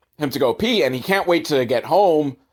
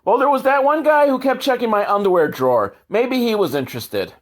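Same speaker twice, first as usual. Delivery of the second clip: higher, airy mock-naive mom voice; ditzy mom; 7 semitones higher than usual. Podcaster mocks the naive mom about a buyer, high and airy. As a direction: sound hopelessly clueless and hopeful. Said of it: "but she thought".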